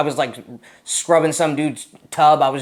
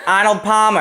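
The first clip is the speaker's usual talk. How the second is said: put-on Boston accent; 8 semitones higher, 7 dB louder than usual. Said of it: "everybody starts dying laughing".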